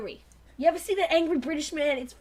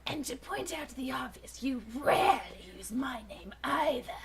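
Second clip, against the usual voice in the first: Dinobot whisper; 4 semitones lower, 8 dB quieter than usual. Acted.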